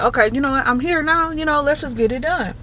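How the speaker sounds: high, upbeat, shrugging agreement; resigned go-ahead. Retold or imitated. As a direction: give in brightly, why not. Agreeing to the implant.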